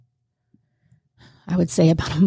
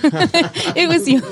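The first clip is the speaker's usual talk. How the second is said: higher, slow words through real laughter; laughing; 10 semitones higher, much slower than usual. Real, not acted. Guest laughing as she credits the host. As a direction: laugh as you give her the credit.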